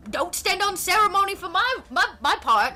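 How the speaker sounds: high, loud Cockney character voice; Walcott Savage Soul Byron. Acted. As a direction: call it out as a Cockney pirate. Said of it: "And he's like".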